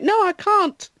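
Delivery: high mock refusal. Wife refusing to leave, high loud mock voice. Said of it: "She was like".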